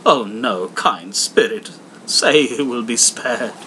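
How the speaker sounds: loud, slow desperate plea; pleading Scrooge. Acted. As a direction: beg the spirit for mercy. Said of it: "Scrooge begs the ghost".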